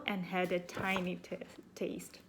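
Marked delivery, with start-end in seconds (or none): none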